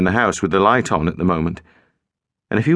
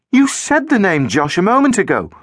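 accusing; 10 semitones higher than usual. A narrator's reading. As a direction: challenge him sharply. Girl accusing the boy.

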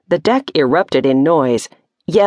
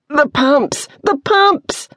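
high, urgent bellowed orders; bawling orders; 11 semitones higher, slower than usual. A narrator's reading. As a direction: bawl it over the din of battle. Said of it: "the captain was bawling orders".

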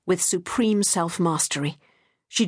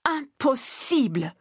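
put-on higher, slow French-accented repeat; slow, higher insistent repeat in a French accent; strong French accent; 6 semitones higher, slower than usual. A narrator's reading. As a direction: drag out Impossible! in a heavy French accent, pitched higher. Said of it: "repeating in his strong French accent".

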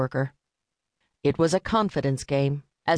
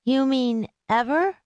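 put-on young boy; 10 semitones higher than usual. A narrator's reading. Boy answers in deposition.

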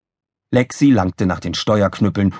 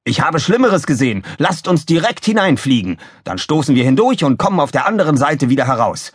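bold, higher-pitched officer voice; Krawall, fearless; 6 semitones higher than usual. A narrator's reading. Officer urges crew into the tornado, fearless. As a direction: sound undaunted and pushy, pitched up. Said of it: "rief Mülloffizier Krawall furchtlos".

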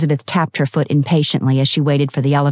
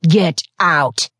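mild roar. A narrator's reading. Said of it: "he roared".

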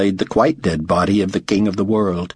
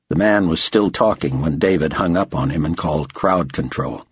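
usual speaking voice

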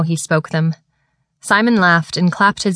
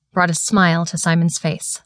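ordinary delivery, nothing marked

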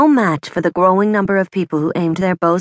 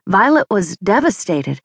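usual speaking voice

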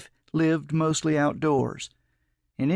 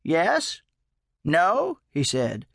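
higher, questioning upward lilt; hopeful prompting; 5 semitones higher, much slower than usual. A narrator's reading. Old man fishing for a yes, hopeful prompting. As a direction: prompt for an answer with rising pitch.

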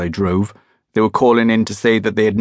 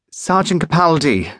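gasped; 8 semitones higher than usual. Acted. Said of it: "the dispatcher gasped".

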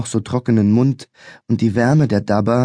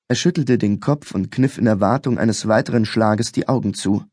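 plain reading voice

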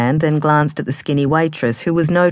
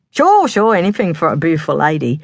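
flirty Cockney; 3 semitones higher than usual. Acted.

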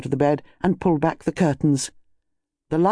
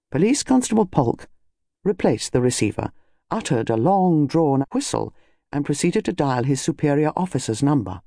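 usual speaking voice

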